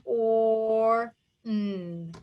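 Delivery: "Or, n" stretched into separate held sounds; teacher tapping out "Or" and "n" one at a time; sounding out. Shown as a demonstration. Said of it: "Tap it out".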